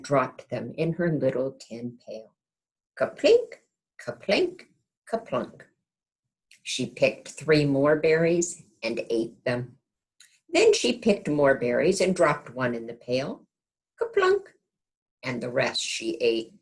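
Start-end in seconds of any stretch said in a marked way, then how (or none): 3.0-4.7 high, bright plinking sound effect; ping it like a berry hitting tin; kaplink sound. Acted. Sound of berries in pail. 14.0-14.5 high popped kaplunk; one berry landing, high kaplunk; kaplunk sound. Acted. Berry drops in pail.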